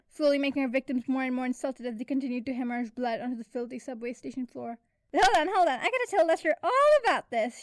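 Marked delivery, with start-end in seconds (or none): 5.1-7.6 loud, high, breathless laugh-talk; wheezing. A narrator's reading. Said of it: "she wheezed".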